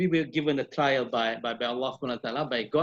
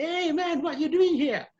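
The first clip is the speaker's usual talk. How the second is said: much higher, loud cheerful greeting shout; friend shouting; 13 semitones higher, 3 dB louder than usual. Retold or imitated. Friend shouting greeting across the cafe, high. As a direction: call out a cheerful hey man. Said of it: "Still shouting".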